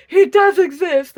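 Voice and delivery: loud high mock-cartoon gasp of amazement; amazed M&M's. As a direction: squeal it like a cartoon candy that just spotted Santa. M&M's seeing Santa in commercial.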